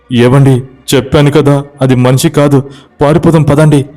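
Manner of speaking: high, urgent frightened plea; panicked. Acted. Wife urging husband to flee the ghost, high panic. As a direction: pull him away in panic. Sita terrified, pulling him away.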